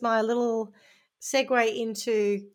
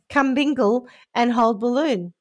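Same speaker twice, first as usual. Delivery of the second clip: usual speaking voice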